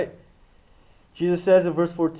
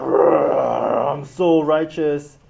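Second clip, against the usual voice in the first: drawn-out, very slow smug boast; smug big-headed; 6 semitones lower, 4 dB louder, much slower than usual. Retold or imitated. Big-headed Peter brags about himself, slow and smug. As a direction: savour your own greatness. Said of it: "Peter's just big headed".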